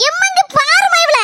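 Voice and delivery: high, whiny mother calling out; a put-upon mum appealing to grandma; complaining mother. Acted. Mother complaining about daughter.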